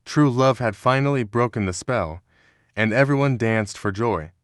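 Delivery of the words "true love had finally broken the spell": usual speaking voice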